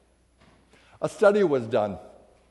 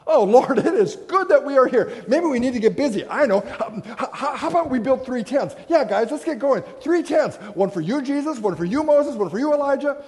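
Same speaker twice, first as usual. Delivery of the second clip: eager Peter; 5 semitones higher, 3 dB louder than usual. Acted. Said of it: "what is Peter's response?".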